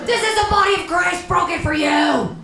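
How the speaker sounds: high-pitched mock yell. Gang kids force-feeding bread to atheist, yelling. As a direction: yell it out like a fanatic chant.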